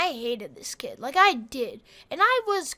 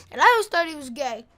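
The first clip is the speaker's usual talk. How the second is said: ordinary delivery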